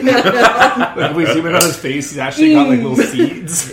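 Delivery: giggling through an orange mascot close-up; laughing description. Pretend. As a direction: crack up while describing the mascot.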